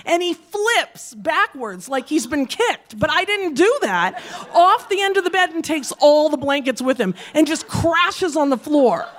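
ordinary talk

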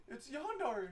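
high mock Tarzan call; goofy hero voice. Joking about a space Tarzan, high goofy voice. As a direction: announce the name in a silly high voice. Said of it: "He's like".

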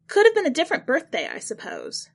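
high, loud, fast start then dropping off; flustered backpedal. Acted. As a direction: hurriedly cover the slip.

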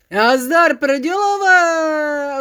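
a loud, long-drawn, slow hail in a put-on voice; loud greeting. The dwarves hail the pirate from behind, loud and slow. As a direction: call out big and slow, stretching prdělové. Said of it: "ozvalo se".

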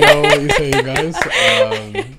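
high, loud laughing hello to the audience; excited laughing greeting. Real, not acted. Guest nervously greeting the viewers, high and laughing. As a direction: burst out a giggly hello.

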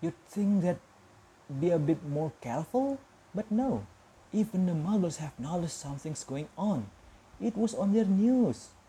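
stern McGonagall. A narrator's reading. McGonagall complaining to Dumbledore about careless wizards, stern and exasperated. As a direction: scold the careless crowd like a strict teacher.

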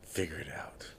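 low, breathy spooky whisper; eerie ghost-story voice. Pretend. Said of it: "That's my spooky voice".

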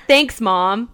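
normal talking voice

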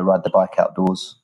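ordinary talk

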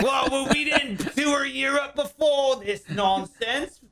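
high, slow mock-indignant rock singer. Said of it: "He's like".

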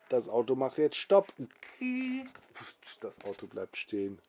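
usual speaking voice

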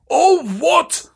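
Hagrid's high, booming outburst; thundering. Acted. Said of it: "Hagrid thundered".

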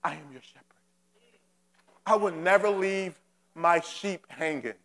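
soft, low and gentle, as God speaking; a hushed, reassuring divine promise; tender voice of God. Acted. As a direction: speak it softly as a loving shepherd. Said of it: "He's saying to you".

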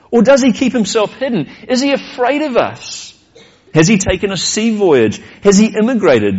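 ordinary talk

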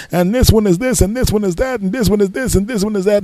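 high, rapid-fire exasperated rant; angry counter guy. Retold or imitated. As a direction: berate the customer in a high mocking voice. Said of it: "Being angry at me".

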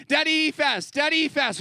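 put-on high, loud little-kid pleading; imitating toddler son. Dad imitating toddler Levi begging, high and loud. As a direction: chant it like an eager two-year-old. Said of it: "he's like".

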